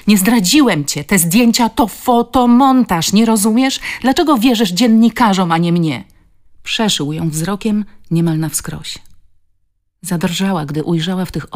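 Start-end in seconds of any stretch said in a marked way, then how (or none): 0.1-6.0 loud, high, impassioned denial; heated outburst of self-defence; desperate protest. A narrator's reading. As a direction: insist at full voice, pleading to be believed. Shouted denial while holding her.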